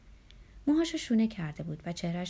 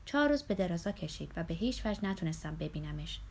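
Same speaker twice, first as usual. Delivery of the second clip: ordinary delivery, nothing marked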